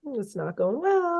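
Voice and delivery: high, soft, drawn-out verdict; sing-song doubt. Retold or imitated. Grader noting failure on his clipboard, sing-song doubt. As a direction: slowly lilt it upward, softly.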